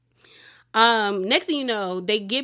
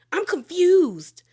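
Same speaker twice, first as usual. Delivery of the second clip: higher, louder baffled retelling; mock confusion; 8 semitones higher, 5 dB louder, slower than usual. Viewer baffled by Kathy's salon tale, mock confused. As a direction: act out being lost by the story. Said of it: "I was like".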